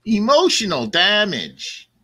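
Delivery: drawn-out, high-pitched comic exclamation; mock catchphrase. Co-host heckles the lawsuit story, mock catchphrase. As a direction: stretch it out as a jokey punchline.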